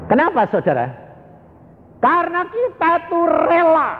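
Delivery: high, driving pulpit voice; fiery preaching. Acted. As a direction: hammer the point home to the congregation. Preaching at full force.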